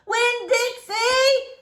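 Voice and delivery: high, slow, drawn-out desperate call; child screaming for her lost dog. A narrator's reading. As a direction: call the dog's name into the rain, stretched long. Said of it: "I screamed".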